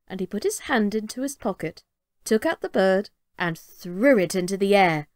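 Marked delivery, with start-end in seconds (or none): none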